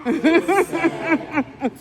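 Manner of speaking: laughing at gold tumors and rats; loud laugh. Real, not acted. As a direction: crack up loudly.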